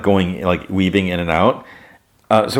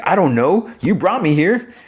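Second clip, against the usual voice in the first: much higher, panicked squeak; high panicky voice; 11 semitones higher than usual. Retold or imitated. Panicked reply under fire.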